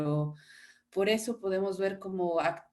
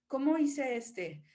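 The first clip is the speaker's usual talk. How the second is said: ordinary talk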